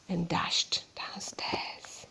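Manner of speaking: breathy hushed whisper. Acted. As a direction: whisper the escape. Hushed narration.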